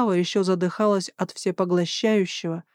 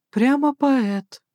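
higher, drawn-out teasing; mock-impressed snort; 6 semitones higher, much slower than usual. A narrator's reading. Slava teases the major over his flowery words, snorting. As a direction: draw it out with a light scoff. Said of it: "фыркнула Слава".

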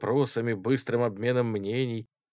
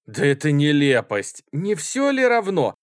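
loud, rising protest; indignant retort; 6 semitones higher, 8 dB louder than usual. A narrator's reading. Student objecting to ranks, indignant. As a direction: dismiss it with a heated outburst.

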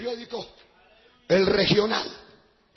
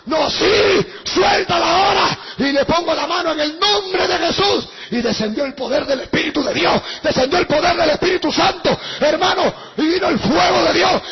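loud, raised exorcism cry; preaching at full force; 4 semitones higher, 7 dB louder than usual. Retold or imitated. Preacher recounts the exorcism to the congregation, full-force shouting. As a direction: drive the story upward, shouting.

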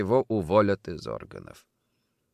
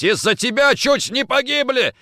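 loud, high enraged accusation; furious yell; 6 dB louder than usual. A narrator's reading. Nikitin still beating Kochkin, furious yell. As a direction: yell the blame at him. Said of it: "орал Никитин".

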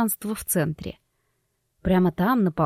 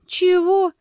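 surprised; 10 semitones higher, 3 dB louder than usual. Acted. Медиум поражена, вскрик.

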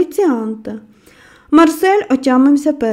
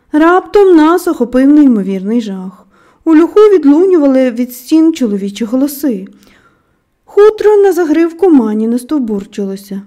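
plain reading voice

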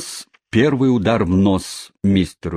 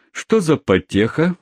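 amused question; 8 semitones higher than usual. Acted. New stranger asks amused.

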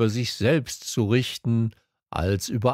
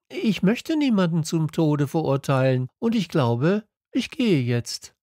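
voiced higher, gently refusing; the little prince, higher, politely firm; 8 semitones higher than usual. A narrator's reading. The little prince refuses to condemn anyone, higher voice.

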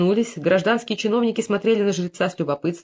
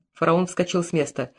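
plain reading voice

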